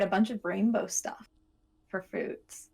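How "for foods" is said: ordinary delivery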